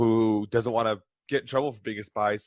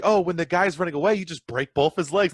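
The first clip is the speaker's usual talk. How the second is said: higher, breezy mock-sweet mom voice; cheerful assassin mom; 6 semitones higher than usual. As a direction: chirp it like a mom sharing a happy dream. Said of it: "like, her".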